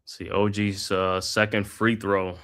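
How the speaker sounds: steady broadcaster's call of the action; live basketball play-by-play commentary. Acted. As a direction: call the game like a courtside announcer. Calls the game play by play.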